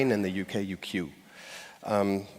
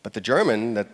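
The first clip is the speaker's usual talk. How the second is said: ordinary talk, nothing marked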